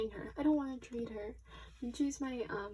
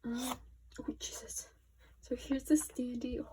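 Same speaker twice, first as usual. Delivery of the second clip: ordinary talk, nothing marked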